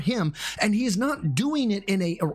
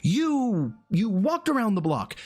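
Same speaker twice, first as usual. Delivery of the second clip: high, emphatic pep talk; earnest encouragement; 6 semitones higher than usual. Retold or imitated. Streamer encouraging a discouraged beginner, high and insistent. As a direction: convince them with rising insistence, higher pitched. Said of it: "I have to convince them that they're".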